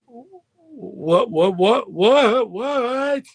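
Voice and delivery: stuttered, rising run of whats, retold; stunned disbelief. Retelling shock at partner quitting.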